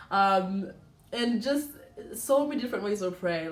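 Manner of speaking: usual speaking voice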